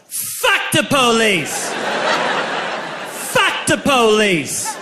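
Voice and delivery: long, high drawn-out yell; drunken yell. Retold or imitated. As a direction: holler it slowly like a chant. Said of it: "I yelled".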